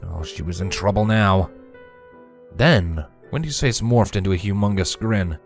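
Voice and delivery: plain reading voice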